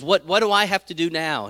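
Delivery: exasperated protest. Acted. Jesus protests to his mother.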